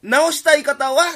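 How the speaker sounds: loud, slow infomercial punchline; hyped announcer shout. Acted. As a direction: belt the pitch line slowly. CMの締め口上. (大声でゆっくり通販の決め台詞)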